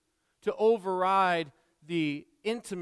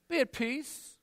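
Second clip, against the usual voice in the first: dismissive; 7 semitones higher, slower than usual. Acted. Believer dismissing the hungry.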